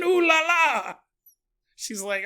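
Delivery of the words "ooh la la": mock French ooh la la, high and bright; hammed-up French exclamation; French accent. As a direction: camp French flourish, way up high. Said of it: "Doing my accent again?".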